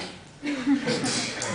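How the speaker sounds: sudden high laugh breaking out; high wheezing laugh; burst of laughter. Real, not acted. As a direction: laugh hard at the memory. Laughs at the prank call.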